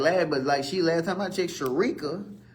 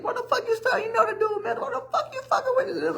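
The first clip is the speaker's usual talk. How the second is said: much higher, laughing disbelief at her; incredulous high squeal. Retold or imitated. Teller to girl baiting a mental man, laughing high disbelief. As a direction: squeal it like you can't believe her nerve. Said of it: "I'm looking at her like".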